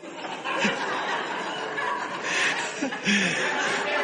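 wheezing laughter trailing off; quiet helpless giggle; soft laughing breath; breathless silent laugh. Real, not acted. Laughing at own confession.

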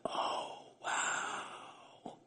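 breathless whispered wow; awed whisper. Retold or imitated. Preacher retelling his whispered awe at God's word. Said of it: "I was like".

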